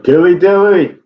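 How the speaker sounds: high sing-out cheer; imitated catchphrase call. Someone in the crowd after the scream, calling out. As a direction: mimic a random fan calling out high.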